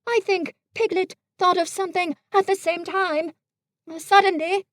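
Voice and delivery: Pooh, innocent and fumbling; Pooh bear voice. A narrator's reading. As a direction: play a guileless little bear. Pooh speaking in the story.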